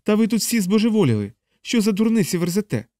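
high-pitched indignant outburst; fed-up, outraged chaplain. Acted. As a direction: lash out with pitch way up. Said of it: "забувши від злості й обурення".

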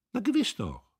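high, gloating voice of Guste; lightly voiced gloating young woman; triumphant Guste. A narrator's reading. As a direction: crow it out in triumph. Guste triumphs over Emmy.

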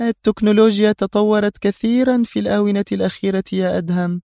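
high-pitched woman boss, teasing; playful villainess. A narrator's reading. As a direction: toy with your captive, amused. Villainess voiced by narrator. (زعيمة عابثة بصوت عالي الطبقة)

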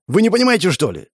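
high-starting, exasperated question; indignant. A narrator's reading. Man arguing with his boss over delay, indignant. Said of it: "возмутился я".

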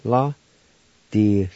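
normal talking voice

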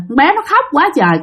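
usual speaking voice

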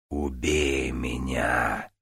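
low, slow desperate demon plea; отчаянный шёпот демона. Acted. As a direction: beg like a broken fire demon. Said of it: "Голос демона был похож на шёпот, в нём звучало самое мрачное отчаяние". (мольба демона в отчаянии)

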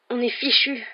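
usual speaking voice

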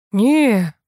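drawn-out listless no. A narrator's reading. Vasya answering the administrator about the crash, listless. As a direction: answer sluggishly but audibly. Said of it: "вяло откликнулся Вася".

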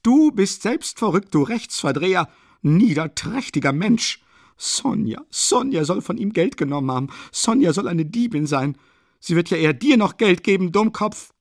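high, loud, indignant railing; screamed tirade. A narrator's reading. Katerina defending Sonja against Luschin, screamed indignation. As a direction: hurl the insults out at full pitch. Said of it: "schrie Katerina Iwanowna".

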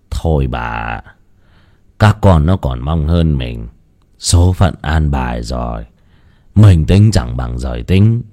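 put-on low, resigned old man consoling his wife; resigned old husband. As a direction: sigh it out to her, deep and settled. Ông chồng an ủi vợ. (giọng ông lão trầm, cam chịu)

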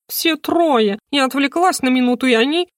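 high, fluttery and breathless; agitated bird voice. Acted. Talking bird flapping round the room, high and alarmed. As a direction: squawk it out in a flap. Хрумса in a flap.

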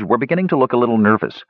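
usual speaking voice

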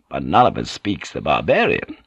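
hesitant, awkward objection; understated unease from a captain; uncomfortable. A narrator's reading. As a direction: admit it with mild discomfort. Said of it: "The captain continued uncomfortably".

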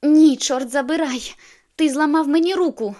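high furious yell; furious shout. A narrator's reading. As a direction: scream at him in pain. Angry shout in pain.

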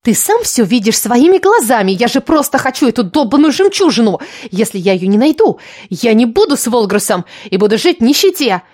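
put-on worked-up girl arguing for what she wants; desperate schemer Lilya. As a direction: plead your case with exasperation.

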